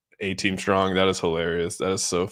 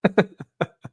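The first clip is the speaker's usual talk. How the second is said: short high burst of laughter; 10 semitones higher than usual. Real, not acted. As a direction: laugh out at the chat. Streamer laughing at chat.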